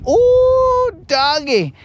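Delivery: high falsetto "ooh" then "doggy"; thrilled "ooh, doggy" holler; excited whoop. Real, not acted. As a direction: hype up the song. Excited exclamation about music.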